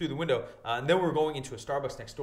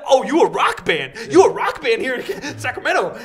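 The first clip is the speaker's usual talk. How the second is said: imitating excited stranger; 9 semitones higher, 10 dB louder than usual. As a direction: holler it like a clueless fan. Voicing a mistaken, excited stranger.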